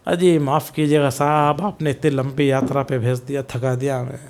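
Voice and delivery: low, soft, worn-out apology; weary traveler. Acted. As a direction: play an exhausted seeker giving up, voice sunk low. Acting the weary returning traveler.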